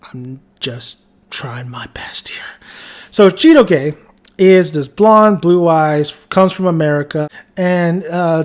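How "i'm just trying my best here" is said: sheepish whisper, slowed down; whispered aside. Real, not acted. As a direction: whisper it like a confession to the camera. Sheepish aside after apologizing.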